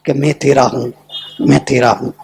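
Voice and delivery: low, soft repeated murmur; acted reply, hushed and low; confiding denial. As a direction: drop right down and say it quietly. Note contrasts with its own answer.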